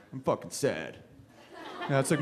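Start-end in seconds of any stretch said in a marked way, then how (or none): 0.1-1.0 low, quiet moping; mopey low voice. Retold or imitated. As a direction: sulk it out, deflated. Mopey human voice.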